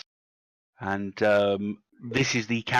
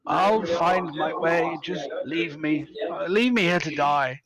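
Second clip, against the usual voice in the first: slow, higher, drawn-out martyr's plea; self-pitying elf; 5 semitones higher, slower than usual. Acted. Injured elf telling companions to leave them to die, moaning. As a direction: moan it out slowly, feeling sorry for yourself.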